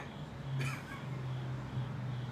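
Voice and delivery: high breathless silent giggle; cracking up, can't hold it in; helpless laugh. Real, not acted. Father cracking up over his daughter's logic, airy laugh. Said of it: "I can't say it with a straight face".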